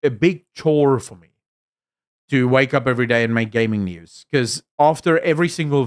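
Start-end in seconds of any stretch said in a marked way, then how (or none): none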